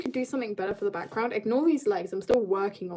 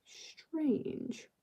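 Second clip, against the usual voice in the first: whispered strange; 5 semitones higher, 13 dB quieter than usual. Real, not acted. Whispered remark at dry ink.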